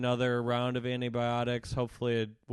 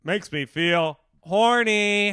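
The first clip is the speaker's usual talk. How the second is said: sitcom character; 8 semitones higher, 10 dB louder, much slower than usual. Pretend. Mock sitcom line.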